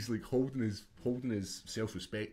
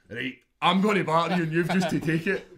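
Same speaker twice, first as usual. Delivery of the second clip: higher and louder, a bossy big brother; big brother threat; 7 semitones higher, 12 dB louder than usual. Retold or imitated. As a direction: menace your wee brother with the gloves on. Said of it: "he'd just come in like".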